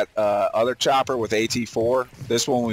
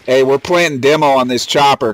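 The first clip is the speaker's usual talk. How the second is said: calling out; 7 dB louder than usual. Real, not acted. Calling out to squad in combat.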